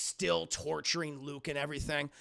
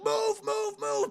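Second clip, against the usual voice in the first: much higher urgent yell, louder; shouted orders; 5 dB louder, slower than usual. Retold or imitated. Rebels flee the bunker yelling to move. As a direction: yell at the squad to run. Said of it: "they run out like".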